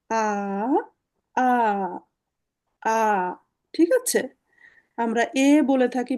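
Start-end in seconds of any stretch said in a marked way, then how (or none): 0.1-3.0 আ said as a long open a, slowly repeated; teacher models আ as an open ah; German letter A. Shown as a demonstration. Teacher shows students the German A, drawn-out demo. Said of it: "এটার উচ্চারণ হলো".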